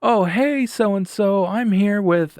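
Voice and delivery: loud, very high put-on friendliness; overly cheery pretence. Caller bluffing to his coworker, loud and falsely cheery. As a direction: pitch it way up like a breezy bluff.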